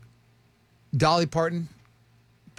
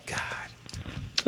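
whispered; 9 dB quieter than usual. Real, not acted. Host reacts under breath.

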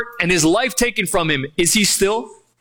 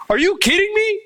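high-pitched disbelieving question; incredulous; 12 semitones higher than usual. Acted. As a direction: ask it in exasperated disbelief, voice leaping up. Mocks the people's disbelief.

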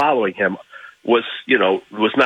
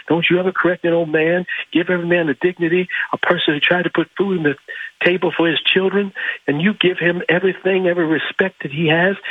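higher, earnest Ali voice, slowing at the end; 9 semitones higher than usual. Retold or imitated. Ali teaching the crowd about dignity, higher and earnest. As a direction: lecture them gently as the champ. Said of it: "He says".

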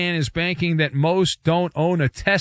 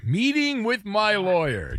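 mocking echo; 3 semitones higher, slower than usual. Host mockingly echoes caller.

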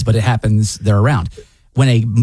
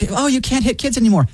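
mocking; 9 semitones higher than usual. Mocking quote of others.

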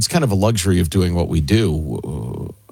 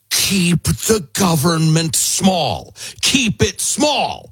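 slow, high, emphatic warning; founding father role; 8 semitones higher, slower than usual. Acted. Founders speaking from the past to posterity, slow stern warning. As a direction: spell it out slowly as a stern order. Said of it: "they said from seventeen eighty-nine".

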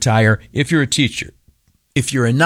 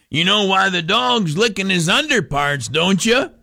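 grandpa voice; 7 semitones higher than usual. Retold or imitated. Grandpa repeats his riddle.

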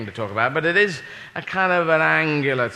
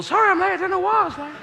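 put-on much higher boyish squeak; squeaky boy voice; 14 semitones higher, faster than usual. Schoolboy apologising for lateness in a high squeak. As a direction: play a boy before puberty. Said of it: "Go into school".

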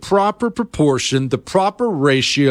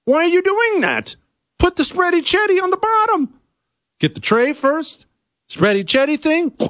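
much higher, exasperated telling-off; exasperated high scolding. Acted. Scolding the gas station customer, high and exasperated. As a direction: snap at them to put cheese first.

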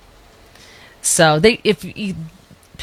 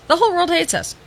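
much higher, sarcastic singsong on "whole"; mocking repeat; 11 semitones higher than usual. Shown as a demonstration. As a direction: echo it back in a mocking high voice.

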